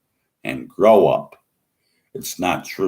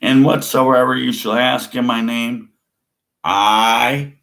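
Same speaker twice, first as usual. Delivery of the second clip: proclaiming; 6 semitones higher, 7 dB louder than usual. Acted. Proclaiming a verse.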